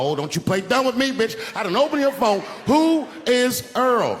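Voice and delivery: mock indignant accusation; accusing yell. Accusing wife about phone.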